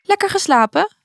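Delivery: bright-eyed, high-pitched morning question; cheerful. A narrator's reading. Little prince greets the girl at breakfast, cheerful. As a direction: ask it cheerily, pitched well up. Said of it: "vroeg het prinsje opgewekt".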